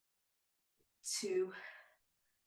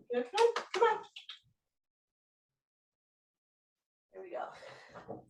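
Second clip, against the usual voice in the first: high-pitched pet talk; 11 semitones higher than usual. Real, not acted. Coaxing the dog Coco in.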